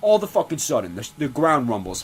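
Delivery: ordinary delivery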